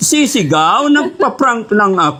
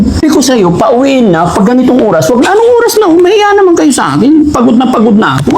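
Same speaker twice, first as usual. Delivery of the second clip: loud, mock-angry telling-off; prank scolding; 2 semitones higher, 6 dB louder than usual. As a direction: scold them loudly, feigning exasperation. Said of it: "masigawan tsaka mapagalitan".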